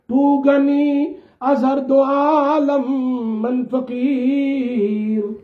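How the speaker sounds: drawn-out, lilting Persian couplet; slow recited verse. Acted. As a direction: chant each phrase slowly, almost sung.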